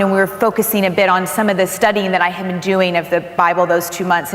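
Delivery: ordinary talk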